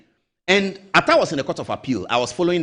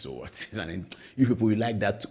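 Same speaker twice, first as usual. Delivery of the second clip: much lower, much softer, offhand shrug of a voice; Akufo-Addo, unbothered; 6 semitones lower, 7 dB quieter than usual. Retold or imitated. President dismisses his party hawks, low and offhand. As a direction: brush it off, low and quiet. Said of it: "Akufo-Addo says".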